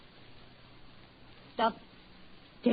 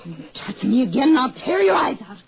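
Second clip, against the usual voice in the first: furious threat; 3 semitones higher, 5 dB louder, faster than usual. Acted. Wife threatening husband who grabbed her, rising furious threat. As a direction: spit the threat out, building fast.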